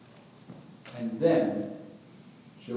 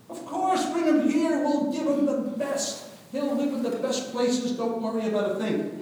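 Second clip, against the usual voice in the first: much higher, louder eager host; generous, delighted welcome; 13 semitones higher, 5 dB louder than usual. Acted. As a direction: Pharaoh welcoming Joseph's family, high and excited. Pharaoh eagerly welcomes the family.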